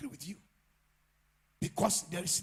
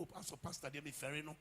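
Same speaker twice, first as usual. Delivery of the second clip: ordinary talk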